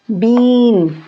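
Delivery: बीन with a long, drawn-out ee. Shown as a demonstration. Teacher showing students the sound, बीन with long ee. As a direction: stretch the vowel in बीन like a big ई. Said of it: "बड़ी ई की तरह बोला है".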